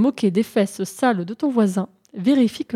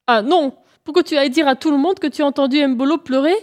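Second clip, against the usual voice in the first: higher, fast indignant protest; indignant refusal; 5 semitones higher, 3 dB louder, faster than usual. Acted. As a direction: refuse in a huffy rush. Lièvre refuse, vexé. (refus vexé et rapide)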